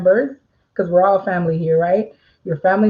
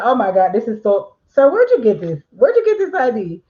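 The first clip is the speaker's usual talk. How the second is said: hammed-up accusing voice climbing much higher; mock accusation; 7 semitones higher than usual. Notary mocking how not to talk to a customer, high, incredulous. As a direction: scoff and interrogate, pitch rising. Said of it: "Don't be like".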